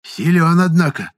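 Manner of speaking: high, loud, impressed acknowledgement; impressed old fortune-teller; respectful. A narrator's reading. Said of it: "уважительно констатировала женщина".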